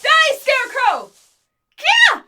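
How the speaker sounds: high, loud attacking yell; loud battle shout then triumphant yell; battle cry. Acted. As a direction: charge in screaming. Battle cry against scarecrows.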